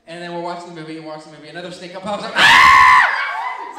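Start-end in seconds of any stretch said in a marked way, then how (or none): 2.4-3.7 very loud mock shriek; full-blast comic terror scream; baby goat scream. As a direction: imitate the brother's bleating scream of fright. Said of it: "he screams like a little baby goat".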